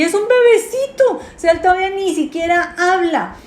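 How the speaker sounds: high, cooing voice, as if over a cradle; cooing baby talk. Acted.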